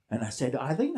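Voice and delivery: ordinary delivery, nothing marked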